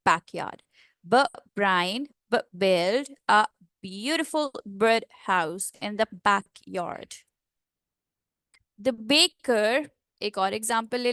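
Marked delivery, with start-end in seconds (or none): 1.0-7.2 slow, lower, every b popped hard; bilabial drill. Shown as a demonstration. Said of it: "pronounce it using bilabials". 8.8-9.9 slow "baker" with the b popped; plosive demo. Shown as a demonstration. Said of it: "pronounce it using bilabials".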